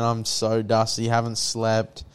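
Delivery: ordinary talk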